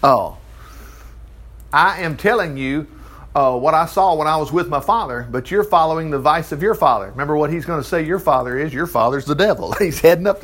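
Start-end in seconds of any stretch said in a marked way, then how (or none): none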